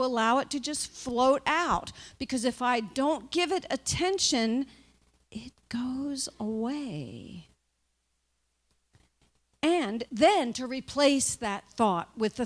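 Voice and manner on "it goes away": slow, airy whisper; very slow, hushed release; fading away. Shown as a demonstration. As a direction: let the words drift off in a soft whisper. Shows a thought floating away.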